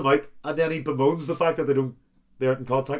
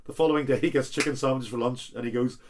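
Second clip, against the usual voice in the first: ordinary talk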